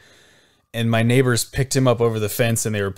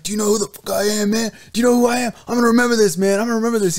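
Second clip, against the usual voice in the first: put-on high-pitched, worked-up drunk threat; pissed-off drunk; 11 semitones higher, 4 dB louder, much faster than usual. Drunk man threatening the neighbor, pissed off. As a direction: act a drunk getting indignant, pitched way up. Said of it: "started getting pissed off".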